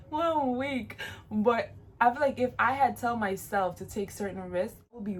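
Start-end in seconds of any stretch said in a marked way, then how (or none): 0.0-1.0 laughing. Real, not acted.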